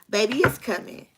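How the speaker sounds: ordinary delivery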